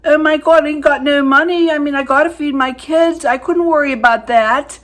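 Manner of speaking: loud, high, brash protest; Aunt Vic. Retold or imitated. Aunt justifying feeding her kids, loud hammed-up indignation. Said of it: "And she'd go".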